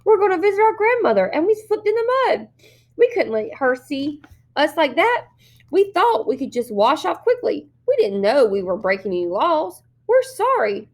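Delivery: put-on little girl pleading with policemen; sweet childish pleading. A narrator's reading. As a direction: play the innocent small child begging. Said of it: "her little girl voice sounding even more sweet and childish".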